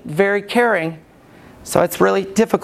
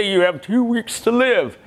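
imagined doctor. Retold or imitated. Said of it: "he's gonna say".

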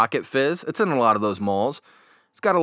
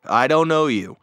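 deadpan; 5 semitones higher, 5 dB louder than usual. Retold or imitated. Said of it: "And Roman goes".

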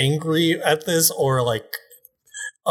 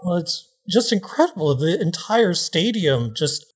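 news reporter; 3 semitones higher than usual. Acted. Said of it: "Channel Five News signing off".

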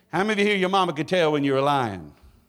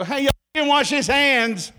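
high, loud mock shout at a stranger; mock callout; 10 semitones higher, 7 dB louder than usual. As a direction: call him out, hammed up. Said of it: "I want to come out and go".

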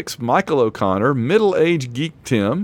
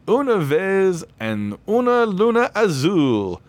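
slow, higher, hammed-up Spanish accent; terrible Spanish accent; 7 semitones higher, slower than usual. Shown as a demonstration. Host reading a patron's name in a terrible Spanish accent. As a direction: mangle the Spanish with an overdone Spanish accent. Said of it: "I say with a terrible accent".

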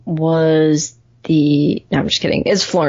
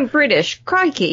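mock British accent; 6 semitones higher than usual. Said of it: "What if you're like".

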